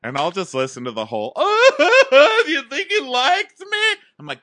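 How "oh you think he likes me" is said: very high, loud blubbering wail; hammed-up crying girl begging for reassurance; mock drunken-night sobbing. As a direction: squeal it through fake sobs. Imitating crazy crying girl.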